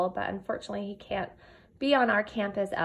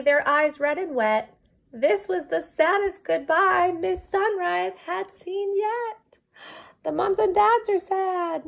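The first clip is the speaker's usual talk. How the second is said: lilting storybook read-aloud; reading to children; 3 semitones higher, 8 dB louder than usual. Acted. Teacher reading a rhyming book to her kindergarten class, storybook lilt. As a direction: read the rhyme to your class, gently bouncing.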